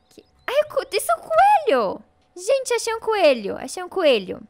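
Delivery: loud, high-pitched excited squeal; thrilled. Real, not acted. Streamer tells viewers she found a rabbit, thrilled. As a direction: announce the find at full excitement.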